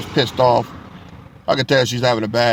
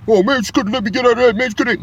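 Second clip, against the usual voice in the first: much higher, fast sulky whining; mock whiny complaint; 11 semitones higher, 3 dB louder than usual. Younger him sulking over the ticket, mock high whine. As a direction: whine like a sore younger self. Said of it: "If I was younger, I'd have been mad".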